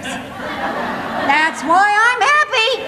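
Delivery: high, very drawn-out punchline; emphatic happy retort. Acted. Comedian answering her sister's jab about men, drawn-out gleeful retort.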